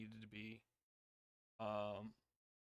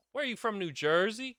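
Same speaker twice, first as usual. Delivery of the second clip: higher, louder teasing question; mocking jab; 10 semitones higher, 10 dB louder than usual. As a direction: needle the lighting guy. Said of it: "and he goes".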